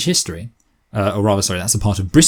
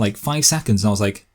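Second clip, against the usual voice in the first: ordinary delivery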